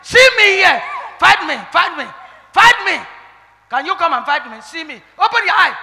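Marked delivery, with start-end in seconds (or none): none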